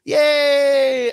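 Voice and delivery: high, loud cartoon yay; mock joyful cheer. Fan mocking Mando seeing Grogu, high loud mock cheer. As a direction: squeal it like a delighted kid. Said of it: "he's like".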